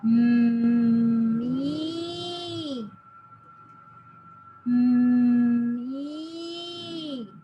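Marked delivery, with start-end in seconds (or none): 0.0-1.4 loud held mm; demo m sound. Shown as a demonstration. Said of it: "look at my mouth". 4.7-5.9 loud held mm; demo m sound. Shown as a demonstration. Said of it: "look at my mouth".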